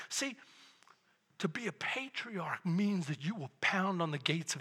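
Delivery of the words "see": normal talking voice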